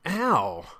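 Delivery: loud, high ow; yelp of pain. Acted. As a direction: cry out as if slapped. Jeremy is slapped.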